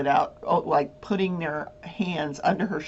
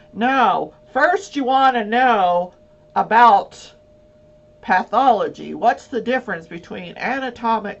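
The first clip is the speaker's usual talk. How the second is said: a hint of a lecturing expert, louder and slow; know-it-all expert; 3 semitones higher, 6 dB louder than usual. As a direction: lightly play a know-it-all lecturing the lawyer. Know-it-all medical examiner lecturing attorney.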